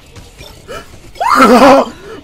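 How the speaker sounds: high, loud shaken cry; real startled holler; frightened outburst. Real, not acted. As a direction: burst out loudly after a scare. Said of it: "I'm actually shaking right now".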